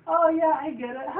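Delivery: hammed-up dim pony catching on; dopey cartoon pony. Pony acting dumb about lettuce.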